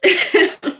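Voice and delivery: short high burst of laughter; a quick nervous laugh. Real, not acted. Laughing at own panic.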